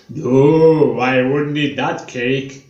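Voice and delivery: slow, odd-voiced disapproving dentist. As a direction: warn them off the cake in a strange slow voice. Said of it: "He's got quite an odd voice".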